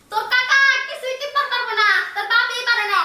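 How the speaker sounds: high mocking call to the baby; loud taunt. Mother tells baby about useless uncle, loud taunt. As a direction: jeer at him by way of the child.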